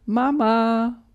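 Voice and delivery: put-on child calling Mama from another room; a boy calling for his mother, lightly voiced. A narrator's reading. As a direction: call Mama across the house. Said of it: "ruft Tom aus dem Wohnzimmer".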